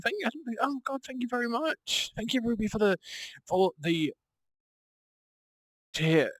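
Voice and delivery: high squealing campy thanks; campy drag queen. Real, not acted. Thanking viewer for tier three sub.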